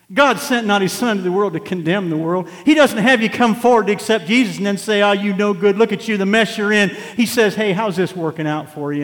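loud, high, driving sermon voice; full-force preaching. Acted. Preacher rousing the congregation, loud full-force preaching. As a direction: preach it hard to the back pews.

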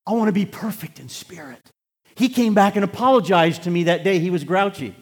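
loud, high preacher's declaration; impassioned pulpit outburst; full-force preaching. Real, not acted. Bursts back into preaching.

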